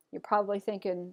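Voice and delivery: usual speaking voice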